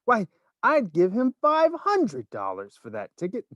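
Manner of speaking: high indignant woman; woman's voice. A narrator's reading. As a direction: outbid them, indignant. Woman outbids.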